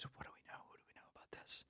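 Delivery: very soft, breath-only whisper; retold furtive whisper. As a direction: imitate a whispered aside to an aide. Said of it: "He leans over and says".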